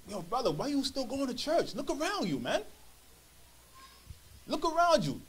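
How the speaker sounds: a hint of a scoffing friend egging him on; mocking tempter. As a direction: tease him for still going to church. A sinner taunting a churchgoer.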